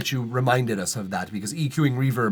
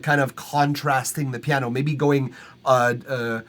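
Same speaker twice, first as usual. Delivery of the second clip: normal talking voice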